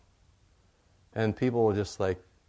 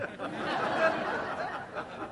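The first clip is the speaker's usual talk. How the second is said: real laugh; 4 dB quieter than usual. Real, not acted. Pastor laughs at own joke.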